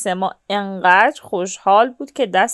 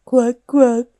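short, louder quacks near usual pitch; joining-in duck quack; 8 dB louder than usual. A narrator's reading. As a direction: quack along with the ducks, briskly. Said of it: "هم صدا شد".

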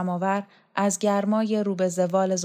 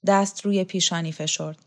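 ordinary delivery, nothing marked

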